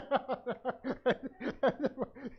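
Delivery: loud high cackling laugh; real amused laughter; high burst of laughter. Real, not acted. Laughs at own anecdote.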